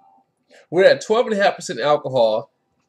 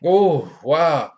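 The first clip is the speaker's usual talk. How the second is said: wowed; 5 dB louder than usual. Real, not acted. Reacting to the aroma.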